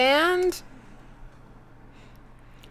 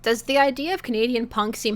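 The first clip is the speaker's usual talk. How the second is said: ordinary delivery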